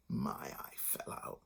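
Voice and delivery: slow whispered mimicry; mock their excuse in a whisper. A narrator's reading. Mother mocks the excuse.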